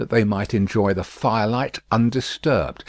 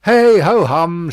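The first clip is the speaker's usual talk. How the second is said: slow, louder, resigned hum; cheerful sigh; 7 semitones higher, 9 dB louder than usual. A narrator's reading. Miss Pross cheerfully humming away a sigh, slow. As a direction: brush off worry cheerfully. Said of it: "cheerfully repressing a sigh".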